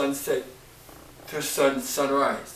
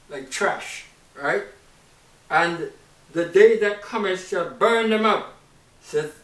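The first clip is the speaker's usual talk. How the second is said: scripture reading; 3 semitones higher, 6 dB louder than usual. Acted. Preacher reading Malachi to the congregation, declaiming. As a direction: declaim the verse like a prophet.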